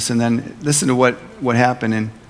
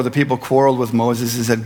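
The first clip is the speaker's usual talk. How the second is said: ordinary delivery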